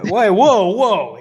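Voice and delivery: surprised. Real, not acted. Surprised shout at insult.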